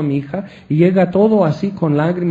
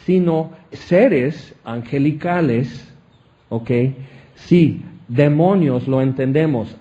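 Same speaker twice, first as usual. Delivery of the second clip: ordinary talk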